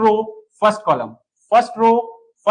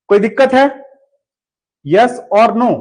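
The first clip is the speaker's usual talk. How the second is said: louder call to the class; 3 semitones higher, 8 dB louder than usual. Real, not acted. Teacher checks if students had trouble, calling out loudly. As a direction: raise your voice to reach the students.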